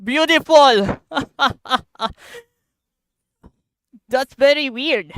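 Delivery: loud, high excited gasps with laughter; can't believe how strong it is; amazed. Real, not acted. Gamer marvelling at the explosion, loud giddy excitement.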